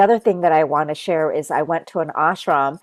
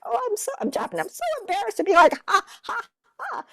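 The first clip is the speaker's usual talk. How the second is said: high, fast, flustered little voice; embarrassed self; 4 dB quieter, much faster than usual. Retold or imitated. Telling of feeling too embarrassed to chant, in a high, fast, flustered voice. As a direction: play your shy self, fast and much higher. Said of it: "And I'm like".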